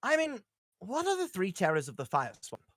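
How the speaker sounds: usual speaking voice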